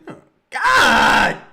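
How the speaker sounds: loud pained shout; anguished cry. Real, not acted. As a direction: cry out in frustration.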